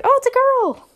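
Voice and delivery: high, loud squeal of celebration; hammed-up party cheer; mock excited announcement. Family at a gender reveal party, mock excited squeal.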